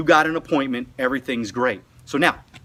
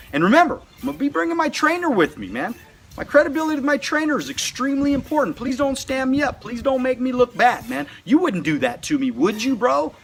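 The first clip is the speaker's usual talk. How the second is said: fast, higher, eager plea to a friend; urgent pleading; 8 semitones higher than usual. Acted. Recruit begging his brother not to skip the appointment, fast and eager. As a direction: hurry him along, don't let me down.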